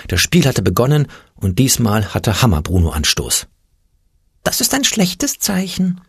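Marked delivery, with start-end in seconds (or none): 4.4-6.0 Angstpeter whispering. A narrator's reading. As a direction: hush it like a timid imp with bad news. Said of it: "flüsterte der Angstpeter".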